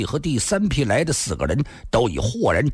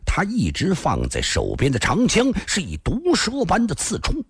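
plain reading voice

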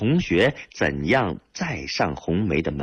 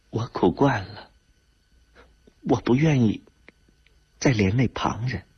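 higher, halting voice with pauses between phrases; tearful; 5 semitones higher than usual. A narrator's reading. Widow turns away from her friend, tearful. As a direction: say it through tears, mildly acted. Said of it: "一边抹眼泪一边说".

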